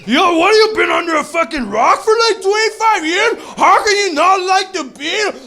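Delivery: high, loud, slow indignant shout. Retold or imitated. Beatles fans yelling at comic, hammed-up outrage. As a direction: scream at him like he insulted your band. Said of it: "They're like".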